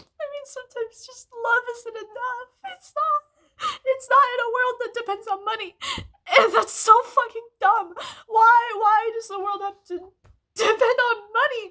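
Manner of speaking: sobbing out the frustration, voice cracking; crying. Real, not acted. Crying about poor parents, money.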